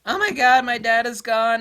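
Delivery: high, loud panicked user; panicked customer. Retold or imitated. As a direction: wail it like a user who lost everything. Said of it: "they would contact me".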